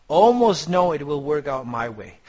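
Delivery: ALMOST hit loud, then falling away through the phrase; stress on ALMOST. Shown as a demonstration. Trader telling students it will work out, ALMOST emphasized. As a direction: lean hard on the word almost. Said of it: "put emphasis on the word almost".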